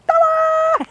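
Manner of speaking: high, loud "ta-da" flourish; showy ta-da. Acted. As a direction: burst in with a big fanfare. Acts out her showy entrance.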